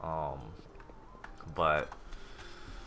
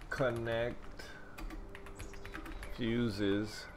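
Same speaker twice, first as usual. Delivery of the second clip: ordinary delivery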